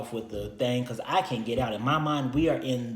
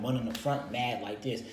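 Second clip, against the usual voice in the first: ordinary talk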